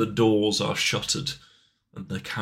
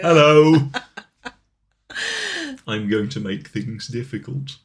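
loud then flat sly villain greeting; hammed-up little villain; silly villain voice; 4 semitones higher, 5 dB louder than usual. Voicing the bagged guy.

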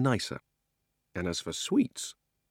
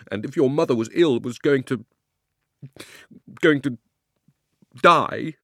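louder, higher, breaking toward tears; wound up, near tears; 6 semitones higher, 6 dB louder than usual. Acted. Diggory pouring out fear for his ill mother to Polly, rising to a tearful cry. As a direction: build to a choked cry on die. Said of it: "too wound up to take any notice".

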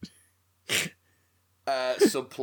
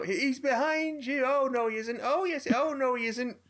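high sing-song crowd call; panto call and response; 12 semitones higher, 3 dB louder than usual. Acted. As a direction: chant it back and forth like a panto crowd.

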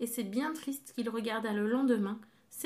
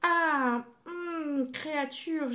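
moaning; 4 semitones higher, much slower than usual. A narrator's reading. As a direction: groan out the words like a wounded snail. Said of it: "gémit l'animal commu".

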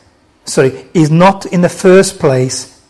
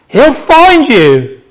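raised, drawn-out pulpit cry; loud, stretched declaration; emphatic assurance; 9 semitones higher, 7 dB louder, slower than usual. Real, not acted. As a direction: call it out high and slow. Preacher calls out assurance to congregation.

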